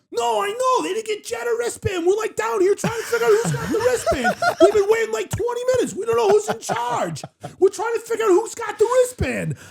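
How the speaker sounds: very high-pitched flustered character voice; flustered Joey Coldcuts. Retold or imitated. Friend imitating Joey Coldcuts down by the stairs without a wristband, flustered. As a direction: squeal it in a panicked, put-on high voice. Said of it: "flustered and doing the voice".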